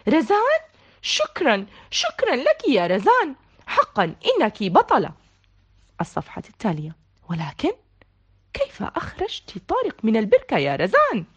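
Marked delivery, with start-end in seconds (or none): 0.1-5.1 calling out thanks. A narrator's reading. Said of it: "صاح الجميع".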